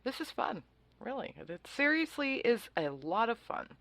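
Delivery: high-pitched mock enthusiasm; facetious. Real, not acted. Radio host joking about a court case, facetious. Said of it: "I'm being very facetious there".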